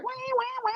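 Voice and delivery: high, soft mouth wah-wah guitar sound; guitar wah imitation. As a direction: sing the wah pedal up high. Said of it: "[name] is like".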